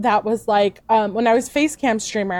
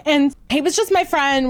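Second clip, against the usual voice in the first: ordinary talk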